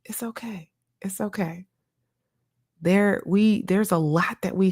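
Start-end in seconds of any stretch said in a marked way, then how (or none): 0.0-1.7 much softer, soothing herself; soft self-comfort. Retold or imitated. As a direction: hush yourself gently, almost under your breath. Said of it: "I'm just like".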